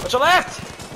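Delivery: high, loud urgent warning; warning shout. Real, not acted. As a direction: yell the warning. Warning teammate, louder.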